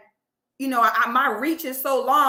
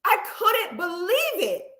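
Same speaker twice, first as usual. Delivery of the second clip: usual speaking voice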